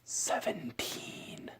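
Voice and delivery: slow, drawn-out whispered numbers; slow ear whisper. Shown as a demonstration. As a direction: whisper each number slowly. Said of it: "I'm gonna whisper to you".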